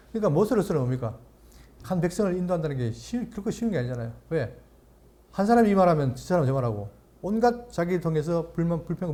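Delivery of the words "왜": ordinary delivery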